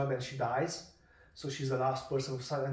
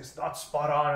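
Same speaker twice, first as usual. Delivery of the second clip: ordinary talk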